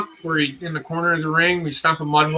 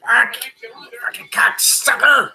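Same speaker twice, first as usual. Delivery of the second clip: pained cursing; 8 semitones higher than usual. Real, not acted. Fan watching wrestling, hit by a hip cramp, pained high curse. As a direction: swear through a sudden hip cramp. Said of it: "I'm getting a cramp in my hip".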